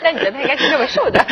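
fast teasing words, then high laugh. Real, not acted. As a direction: tease him while laughing. Host teasing through laughter.

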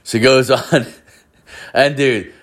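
ordinary talk

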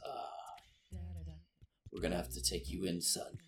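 quiet menace. Acted. Mage hunter quietly threatening the boy, soft and menacing. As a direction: lean in with velvet menace. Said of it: "and he says".

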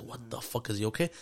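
quietly, retelling the shock; a dad murmuring in alarm; stunned hush. Retold or imitated. Stunned father retelling birth.

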